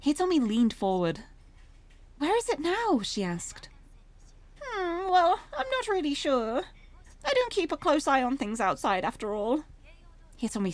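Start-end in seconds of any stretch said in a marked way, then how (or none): 2.2-3.0 higher-pitched character line; a lightly voiced question, pitched up; lightly voiced Hitomi's question. Hitomi asks the soba shop woman, lightly voiced higher question. 4.6-9.6 put-on higher-pitched, very British older woman; chatty shopkeeper, pitched up at the start; soba shop proprietress. Older shop woman answers about the blue van, chatty higher British voice.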